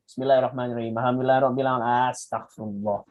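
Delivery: rattled off fast in a low monotone; hurried, run-together recitation; speed-run prayer. Shown as a demonstration. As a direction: race through the prayer without a breath. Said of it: "your speed running through your prayer".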